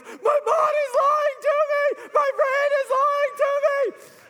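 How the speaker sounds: very high, loud mock wail; hammed-up tearful shriek, words stretched out; crying, wheezing kid. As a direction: imitate an exhausted kid bawling out a mantra. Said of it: "he's wheezing and he's crying".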